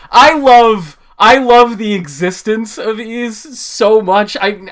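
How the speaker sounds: ordinary talk, nothing marked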